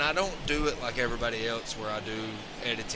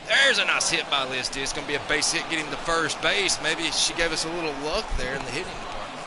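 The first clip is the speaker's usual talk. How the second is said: upbeat sportscaster call of the game; lively game commentary over the action; live play-by-play; 5 semitones higher, 6 dB louder than usual. Acted. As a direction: call each pitch like a TV announcer. Plays and calls a baseball game.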